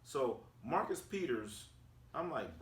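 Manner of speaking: usual speaking voice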